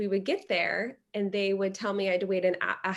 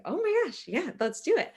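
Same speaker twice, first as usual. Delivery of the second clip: sudden high-pitched surprised exclamation, then eager agreement; surprised squeal; 9 semitones higher than usual. Retold or imitated. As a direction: gasp it out as if caught off guard. Student unexpectedly agrees to speak.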